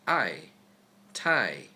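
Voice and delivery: I and tie falling sharply, fourth tone. Shown as a demonstration. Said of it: "You'll just get the fourth tone".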